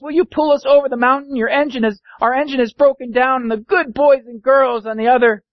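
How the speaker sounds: high, beseeching cry; desperate high-pitched plea; pleading dolls and toys. Acted. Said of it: "cried the dolls and the toys".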